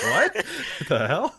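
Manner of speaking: slow, laughing bewildered reaction; puzzled and amused; baffled. Retold or imitated.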